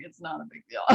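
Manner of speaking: calm dismissal. Retold or imitated. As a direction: brush it off quietly. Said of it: "And she's like".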